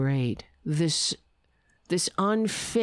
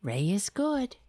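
whispered reassurance to the cat; whispered soothing; 3 semitones higher, 3 dB quieter than usual. Real, not acted. Whispering to calm the cat. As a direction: breathe it softly to calm him. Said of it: "That's what I have to do all day".

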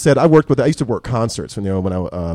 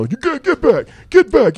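bodyguard; 12 semitones higher, 6 dB louder, slower than usual. Retold or imitated. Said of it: "all of a sudden, you know".

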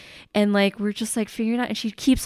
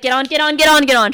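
hurried, repeated command getting louder; retold urgent order; 4 semitones higher, 6 dB louder, much faster than usual. Aunt hurrying niece onto the restarted moped, urgent retold order. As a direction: rush them aboard, building in force.

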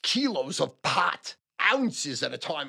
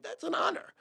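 breathy aside; 13 semitones higher, 4 dB quieter than usual. Retold or imitated. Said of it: "I'm like".

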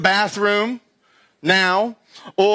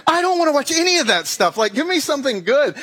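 high, fast, annoyed rant; fed-up complaint; 6 semitones higher, faster than usual. Retold or imitated. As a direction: brush it off like a bored viewer. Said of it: "I'm like".

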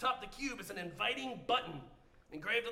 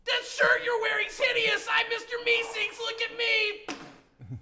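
higher, louder, slow shouted insult; mock the shirt in an eager high shout; cheerful cartoon helper yelling an insult; Mister Meeseeks; 11 semitones higher, 7 dB louder, slower than usual. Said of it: "looks over at you and says".

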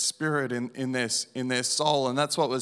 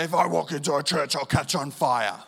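retold yell; 3 dB louder than usual. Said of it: "I'm screaming at her".

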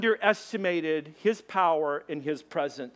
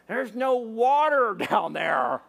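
higher, drawn-out mock disbelief; incredulous exclamation; 4 semitones higher, 4 dB louder, slower than usual. Baffled onlooker at a dry Arizona river, mock incredulous.

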